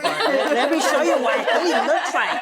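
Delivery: laughing mock threat. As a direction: threaten playfully through laughter.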